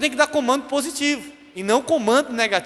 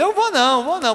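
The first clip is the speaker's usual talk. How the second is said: quick high refusal in mock fear; frightened child; 7 semitones higher than usual. Son afraid of his angry mother, frightened refusal. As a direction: back out like a scared kid, pitched up. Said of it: "Aí eu já ficava com medo".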